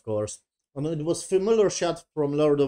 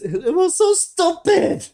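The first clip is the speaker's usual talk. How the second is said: very high, loud, drawn-out outburst; incredulous squeal; 7 dB louder, slower than usual. Real, not acted. Reviewer ridiculing the kneeling scene, incredulous squeal. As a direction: squeal it in scornful disbelief.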